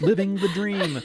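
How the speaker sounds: high-pitched gleeful boast; putting on cheer. A narrator's reading. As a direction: say it high, as if laughing it off. Laughing off her fear to friend.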